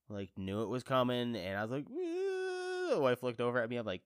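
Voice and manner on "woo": high mock wail. Retelling crying at Lion King.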